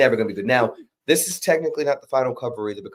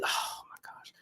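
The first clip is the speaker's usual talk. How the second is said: awed whisper; 13 dB quieter than usual. Real, not acted. Marveling at the deity art.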